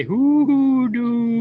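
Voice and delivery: high, loud, long drawn-out spooky call; spooky owl-like hoodoo; scary drawn-out hoodoo. Retold or imitated. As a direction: stretch the word out like an owl hooting in the dark. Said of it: "drawing out the word, scary like an owl in the dark".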